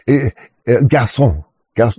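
ordinary talk, nothing marked

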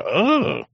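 high-pitched mock "oh" of pain; pained yelp. Showing a friend how moving wrong hurts, high pained yelp. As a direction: let out a sudden high yelp, acting it out. Acts out waking with sudden pain.

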